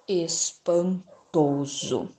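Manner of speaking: slow, hushed astonishment; astonished. Acted. Gruffalo, amazed at the snake fleeing, slow and hushed. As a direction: let the wonder slow you down. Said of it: "falou abismado".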